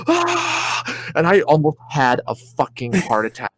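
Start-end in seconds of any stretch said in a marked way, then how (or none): 0.1-0.8 high falling shriek; high scream. Retold or imitated. Let go in the sky, high shriek. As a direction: scream while dropping. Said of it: "I'm like".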